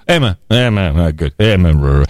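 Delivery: lightly voiced laid-back radio guy; a hint of a chummy radio jock; radio guy imitation. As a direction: toss off short casual greetings like a DJ off air. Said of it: "It's unbelievable".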